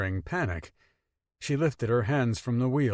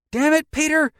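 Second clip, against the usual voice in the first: high, loud angry yell; 9 dB louder than usual. A narrator's reading. Mother yelling at the sulking teen, angry yell. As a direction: snap at him, furious. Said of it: "she yelled".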